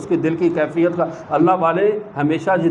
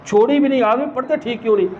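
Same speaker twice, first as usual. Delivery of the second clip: astonished retort; 5 semitones higher, faster than usual. Retold or imitated. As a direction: fire back in amazement, quicker. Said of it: "اس نے کہا". (حیرت سے اونچی، تیز آواز میں)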